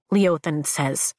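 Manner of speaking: usual speaking voice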